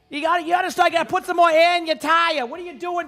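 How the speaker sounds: high gruff Boston helper lecturing a stranded driver; scolding Boston helper. Retold or imitated.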